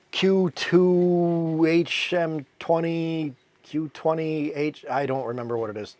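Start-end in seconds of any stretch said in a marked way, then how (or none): 0.1-4.8 very slow, drawn-out letters and numbers; haltingly groping for a model number; unsure recall. Real, not acted. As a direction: stretch each one while searching your memory. Trying to recall engine model name.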